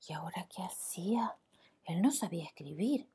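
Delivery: soft whispered worry; worried whisper. A narrator's reading. Narrator to children, whispered worry. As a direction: whisper it like a problem.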